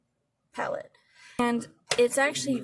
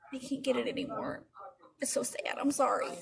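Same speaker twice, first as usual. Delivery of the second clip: sad whisper; 4 dB quieter than usual. Real, not acted. Palette no longer sold.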